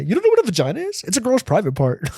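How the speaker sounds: put-on very high, fast, squeaky kid voice; know-it-all kid. Boy repeats his lesson to classmates in a squeaky kid voice. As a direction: squeal it like a gleeful little boy. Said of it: "I still think about that to this day".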